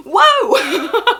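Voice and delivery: loud laughing whoa; excited whoa. Real, not acted. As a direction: cheer it out, laughing and high. Mock amazement at one like.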